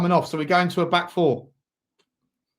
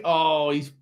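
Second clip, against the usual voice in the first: ordinary talk, nothing marked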